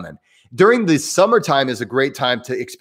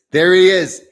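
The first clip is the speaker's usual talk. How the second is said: higher, louder, playful "there he is"; gleeful gotcha; 6 semitones higher, 6 dB louder than usual. Real, not acted. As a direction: caught you, teasing triumph. Catches Papa Geek listening.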